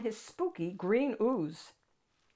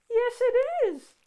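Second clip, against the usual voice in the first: much higher, silky and smooth; high silky monster; 8 dB louder than usual. Acted. Ralph greeting the child, high silky monster voice. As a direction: purr it out, very high and refined. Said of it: "Ralph replied".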